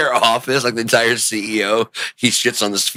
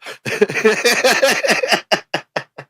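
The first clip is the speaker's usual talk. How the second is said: long, high peal of laughter; high giggling fit that trails on; 11 semitones higher than usual. Real, not acted. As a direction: laugh helplessly at your own bit. Cracks up at the ending.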